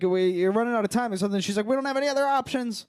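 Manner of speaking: high, rushed mock urgency; urgent panic. Escape room players running out of time, urgent mock panic. Acted quarrel as time runs out.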